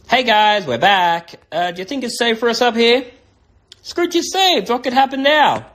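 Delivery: high-pitched, lively sidekick voice; returning sidekick. Acted. Sidekick asks if it's safe up here, high lively character voice. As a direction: pop back in with a high cartoon voice.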